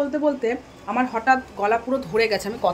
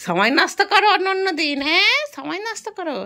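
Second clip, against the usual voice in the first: teasing scold; 7 semitones higher than usual. Real, not acted. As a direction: chide her playfully, pitched up high. Teasing daughter about wasting time. (উঁচু গলায় মজার বকুনি)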